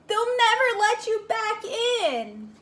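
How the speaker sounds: high, loud warning cry; pleading cry. Acted. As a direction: call after him in alarm. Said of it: "cried Floyd".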